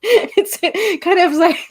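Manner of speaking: laughing. Real, not acted. Laughing at mismatched voice.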